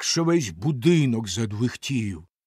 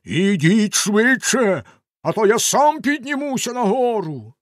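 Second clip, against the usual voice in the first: a loud, slow, higher roar; terrible bellow; 9 semitones higher, 7 dB louder than usual. A narrator's reading. Bluebeard yelling up to his wife, a terrible roar. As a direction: bellow up the stairs. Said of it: "заволав страшним голосом".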